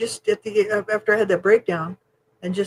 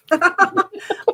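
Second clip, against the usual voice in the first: giggle; 12 semitones higher, 4 dB louder than usual. Real, not acted. Laughing at her cute look.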